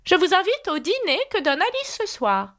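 high-pitched storybook queen; haughty Red Queen. A narrator's reading.

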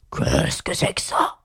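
slow, drowsy beast voice, creaky and drawn out; deep, booming lion. A narrator's reading. Sleepy lion eyeing Alice, deep and slow. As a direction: toll it out like a big bell. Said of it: "en parlant d'une voix basse et profonde".

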